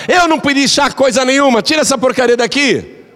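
put-on high, sharp voice of a rude old mother-in-law. Daughter-in-law brings tea, the rude mother-in-law snaps, high and sharp. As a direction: snap it back like a cranky mother-in-law.

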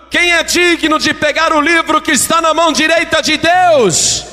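very high, impassioned proclamation; angel's summons. Acted. Angel summoning someone worthy to heaven's throne, crying out. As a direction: call it out to summon all of heaven. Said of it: "a voz do anjo convocando".

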